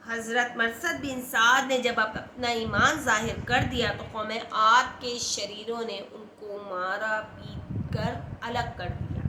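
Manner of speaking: normal talking voice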